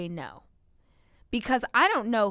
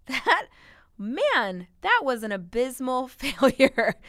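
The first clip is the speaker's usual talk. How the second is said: real laughter breaking through, higher and louder; rueful laughing; 4 semitones higher, 6 dB louder, slower than usual. Real, not acted. Boss admitting a bad hiring failure, laughing ruefully. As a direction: laugh at your own flop as you admit it.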